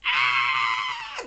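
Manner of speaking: high, loud scream; mock crowd scream. Game master voices people who start screaming, high shriek. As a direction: let out a piercing acted shriek. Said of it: "They start screaming".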